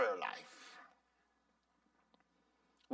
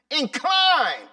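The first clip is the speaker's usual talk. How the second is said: loud, higher "Incline."; punched word; 7 semitones higher, 11 dB louder than usual. Real, not acted. Preacher stressing the psalm word, "Incline." loud. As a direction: hit "Incline." hard.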